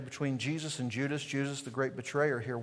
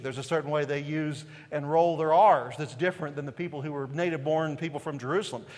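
ordinary talk, nothing marked

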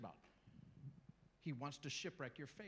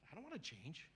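sulky resister; 8 dB quieter than usual. Acted. Resistant person voiced.

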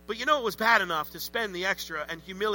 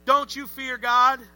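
loud, raised rebuke; rebuking thief; 5 semitones higher, 6 dB louder than usual. Acted. Second thief scolding the first, loud rebuke. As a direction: scold the other thief hard. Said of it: "pipes back at the first guy".

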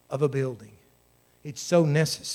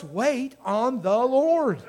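"Wait on the Lord" raised and drawn out; emphatic exhortation; 5 semitones higher, much slower than usual. Real, not acted. Preacher contrasting God with other help, "Wait on the Lord" drawn out. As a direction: stretch "Wait on the Lord" slowly, pitched up. Preacher repeats key command slowly.

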